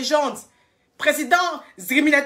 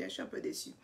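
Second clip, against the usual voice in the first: quiet disappointment; 5 semitones lower, 19 dB quieter, faster than usual. Real, not acted. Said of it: "je suis découragée".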